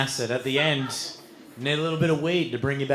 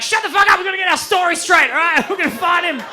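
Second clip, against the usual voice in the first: high, loud, urgent ordering; panicked shouting; 6 dB louder, faster than usual. Acted. Friend to Jules while hunting for buried mate, frantic shouting.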